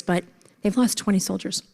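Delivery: normal talking voice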